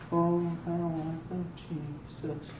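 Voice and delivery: flat, near-silent, slow mumble of "Oh, how I love Jesus"; joyless mumble. Shown as a demonstration. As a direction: sing it with no heart at all, barely audible. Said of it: "If you're doing it like this".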